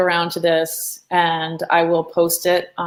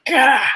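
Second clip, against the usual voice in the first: exasperated "gah" outburst, higher pitched; frustrated growl; 6 semitones higher, 4 dB louder than usual. Retold or imitated. Teacher acting out an artist's frustrated moment, exasperated. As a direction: let out a short burst of frustration. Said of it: "this moment of frustration".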